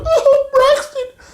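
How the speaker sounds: mock crying in very high falsetto; Allie crying impression. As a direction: whine it out slowly, squeaky and tearful. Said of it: "Stop crying all the time".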